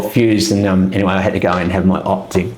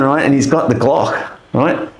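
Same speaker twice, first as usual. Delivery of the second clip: ordinary talk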